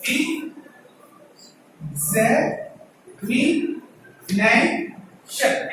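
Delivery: each syllable alone with a pause; teacher separating the sounds one by one; syllable-by-syllable pronunciation demo; broken into syllables. Shown as a demonstration. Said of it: "If you break".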